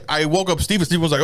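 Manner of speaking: ordinary talk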